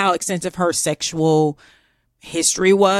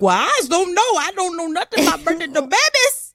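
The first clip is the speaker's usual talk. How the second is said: mock Southern servant; 10 semitones higher than usual. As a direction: play dumb in a hammed-up Southern accent.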